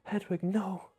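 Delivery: high-pitched mock cry of loss; strained high wail, held back in volume; Harry Potter. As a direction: imitate a boy calling after his dying owl. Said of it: "when Hedwig dies and Harry's like".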